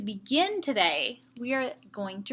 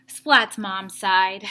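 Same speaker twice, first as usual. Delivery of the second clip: usual speaking voice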